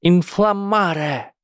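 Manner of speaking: high, strained, slow spell cry; strained high shout. Acted. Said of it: "Высоким от напряжения голосом Трейси выкрикнула". (напряжённый высокий выкрик)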